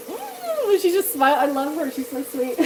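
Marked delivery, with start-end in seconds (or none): 0.1-0.7 mock yummy hum. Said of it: "she's like".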